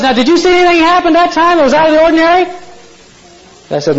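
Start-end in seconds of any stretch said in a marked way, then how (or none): 0.0-2.5 high and fast, bursting with excitement; excited question. Acted. Optimistic farmer presses his neighbor, high and excited. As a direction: rattle it off high and eager.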